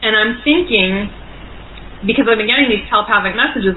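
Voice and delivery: usual speaking voice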